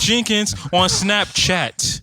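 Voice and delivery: slow promo plug. Real, not acted. Plugging own socials, drawn out.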